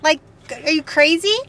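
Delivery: retold incredulous refusal, pitched high; indignant. American driver scoffing at a Canadian parking ticket, indignant. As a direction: object in a high, affronted voice.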